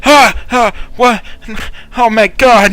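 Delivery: high, loud gasping cries; startled terror. Acted. Revived guard waking on the ground, yelling in fright. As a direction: come to with a frightened yell.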